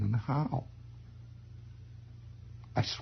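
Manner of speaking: usual speaking voice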